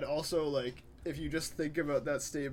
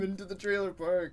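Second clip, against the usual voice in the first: ordinary delivery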